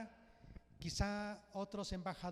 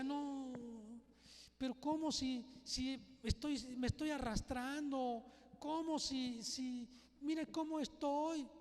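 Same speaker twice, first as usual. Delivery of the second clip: mild tearful plea, pitched higher; 5 semitones higher than usual. Retold or imitated. Preacher voicing Mefiboset's tearful protest to the king, mild tearful plea. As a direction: voice a lame son close to tears. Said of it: "Mefiboset llorando decía".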